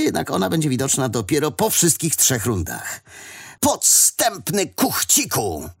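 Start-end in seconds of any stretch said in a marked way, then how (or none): 3.6-5.7 high, drawn-out accusing call; indignant cry. A narrator's reading. Kappa calling out his sneaky friend before round two, drawn-out cry. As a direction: stretch the insult out in a raised voice. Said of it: "krzyknął do Frykasa Kappa".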